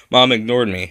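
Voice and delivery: ordinary delivery, nothing marked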